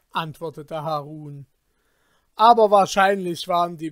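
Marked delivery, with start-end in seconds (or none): none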